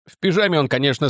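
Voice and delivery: ordinary delivery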